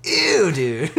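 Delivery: high, loud cry of disgust; grossed out. Real, not acted. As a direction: recoil with a drawn-out, swooping "ew". Recoils at co-host's squirrel saying.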